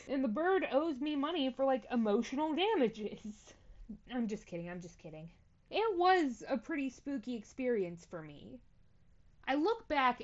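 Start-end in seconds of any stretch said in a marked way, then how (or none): 4.7-5.3 much softer, half under the breath; quiet. Shown as a demonstration. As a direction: drop to a quiet aside. Softer repeat of retraction.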